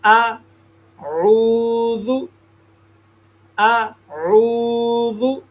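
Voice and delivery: आऊज़ु with the ain drawn long from mid-throat; आऊज़ु, vowel held a full second; correct ain recitation. Shown as a demonstration. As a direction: stretch आऊज़ु slowly, one full beat on the ain. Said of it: "इसको पढ़ेंगे".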